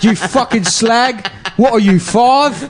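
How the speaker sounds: Snatch Adams. Acted. British comic playing a foul doctor to a sick kid, high hammed-up abuse. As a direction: berate a sick child in a hammed-up English accent. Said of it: "How about Snatch Adams".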